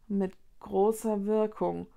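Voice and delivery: ordinary delivery, nothing marked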